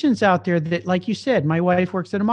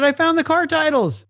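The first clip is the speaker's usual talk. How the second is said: excited discovery; 10 semitones higher than usual. Retold or imitated.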